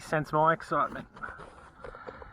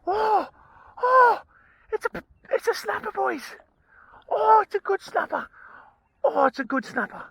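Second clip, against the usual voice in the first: much higher and louder, squealing with real joy; thrilled shriek; 14 semitones higher, 5 dB louder than usual. Real, not acted. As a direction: shriek it out like you just hooked a big one. Landing a snapper, shrieking with joy.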